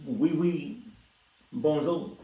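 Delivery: hammed-up French creep offering photos; fake French accent. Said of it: "I was trying to go for, for French".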